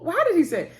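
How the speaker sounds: usual speaking voice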